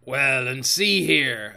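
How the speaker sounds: slow, drawn-out pirate interjection; weighty pirate captain; Silver, emphatic sea cook. A narrator's reading. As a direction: lean on each word like an old sea dog. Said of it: "added the sea cook".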